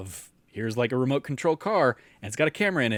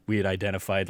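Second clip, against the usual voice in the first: usual speaking voice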